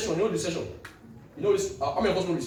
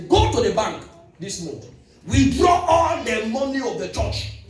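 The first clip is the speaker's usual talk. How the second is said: commanding shout; 5 semitones higher, 7 dB louder, slower than usual. Retold or imitated. Voicing God's command.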